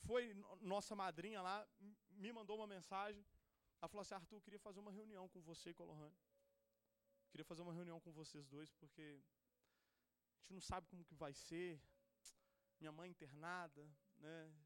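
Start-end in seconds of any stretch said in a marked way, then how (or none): 4.1-14.5 lower, hushed and heavy; retold worried aunt, quiet and low; somber aunt. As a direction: relay the bad news gently. Aunt's worried message retold.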